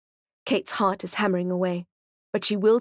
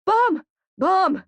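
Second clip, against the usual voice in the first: much higher, louder screaming; panicked yell; 12 semitones higher, 5 dB louder than usual. A narrator's reading. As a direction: scream the warning in terror. Panicked crowd yelling warning.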